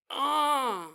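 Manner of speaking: a sudden eerie moan as the sensation hits; brief mock moan for effect. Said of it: "she didn't say that she moaned".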